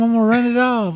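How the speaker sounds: mock panicked yell; retold shout. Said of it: "he said".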